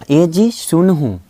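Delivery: "ए जी सुन हूँ" drawn out slowly; mock wife calling her husband, stretched out; slow wife's call. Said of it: "इनके पत्नी बोलते हैं".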